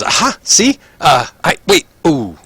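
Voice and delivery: high, excited betting voice; rushing to lay a bet on the fight; eager gambler. Acted. Prisoner placing bets on the orc fight, excited and high.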